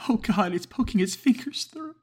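very high, loud shriek of horror; terrified scream. Acted. Caller seeing fingers in the vent, screaming. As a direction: scream as the fingers come through.

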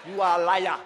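You are a liar in a Jamaican accent, liar as lie-ah; lightly put-on Jamaican delivery. Shown as a demonstration. Preacher showing the Jamaican way to call someone a liar, light Jamaican accent. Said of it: "That's how they say it in Jamaica".

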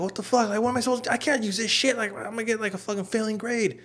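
very high, whining mock anger; mad brother, imitated. Brother got hella mad over notebooks, imitated anger. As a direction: squeal the complaint like an aggrieved brother. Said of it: "he just got hella mad".

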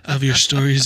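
booming radio announcer read; loud, laughing announcer voice. Acted. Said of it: "that's a good, radio voice right there".

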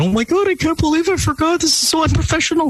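high, hurried apologetic voice; flustered quote. Retold or imitated. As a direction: play her panicking about forgetting. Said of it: "off air and said".

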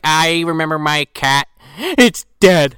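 high, loud hammed-up lament; mock grief. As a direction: wail it like a melodramatic cat lady. Mock grief over dead cat.

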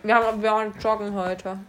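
chewing while talking; speaking around a mouthful of cookie; mouth full. Real, not acted. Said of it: "Man spricht nicht mit vollem Mund".

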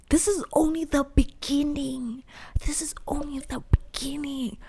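hushed, awed whisper. Retold or imitated. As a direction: whisper it like a promise to yourself. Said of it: "I was like".

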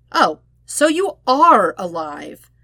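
slow, dry sarcastic drawl. Acted. Sokka mocking Zuko who ignored them, sarcastic. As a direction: drag it out, unimpressed. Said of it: "Sokka said sarcastically".

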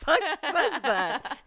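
high, loud, stretched out; incredulous laughing shout. Retold or imitated. Reacting to wanting to be a young dad, laughing yell. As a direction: yell in comic disbelief, cracking up.

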